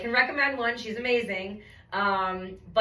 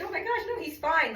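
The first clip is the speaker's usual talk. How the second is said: flustered self; 8 semitones higher than usual. Retold or imitated. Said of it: "And I was like".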